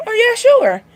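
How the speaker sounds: high-pitched quick agreement; breezy acted agreement. Acting out an agreeing reply.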